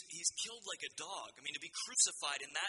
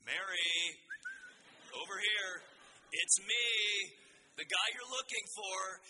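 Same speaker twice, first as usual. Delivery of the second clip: sarcastic Jesus; 3 semitones higher than usual. Acted. Preacher imagines Jesus getting Mary's attention, sarcastic. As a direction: call out as if pointing out the obvious. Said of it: "Jesus isn't afraid to use sarcasm".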